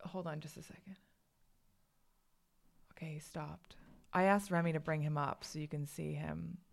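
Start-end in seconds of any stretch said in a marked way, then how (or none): 0.0-3.7 real hushed whisper, almost no voice; whispered aside. Real, not acted. Mom pausing the call to check her crying baby, whispering. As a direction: whisper it, very softly.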